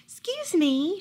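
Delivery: put-on a high, cheerful old woman; brightly. A narrator's reading. Kind old woman politely offers help at the door, brightly. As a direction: pipe up brightly like a sweet grandma. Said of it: "she said brightly".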